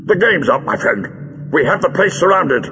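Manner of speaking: stern theatrical announcement. A narrator's reading. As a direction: confront the villain sternly. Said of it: "He turned sternly towards Greal".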